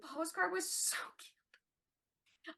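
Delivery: breathless whisper, slow; whispered gush. Real, not acted. Fan gushing over the postcard in a slow whisper. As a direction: whisper it like it's too cute to bear.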